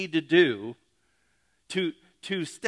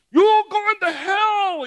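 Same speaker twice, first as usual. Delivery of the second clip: slow, drawn-out hellfire bellow, much higher and louder; mock street preacher yell; 10 dB louder, much slower than usual. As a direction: ham up a megaphone preacher condemning passers-by. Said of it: "with his little megaphone out there on the street".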